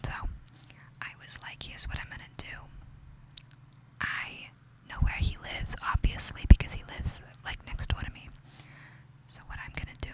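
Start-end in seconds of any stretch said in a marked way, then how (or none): none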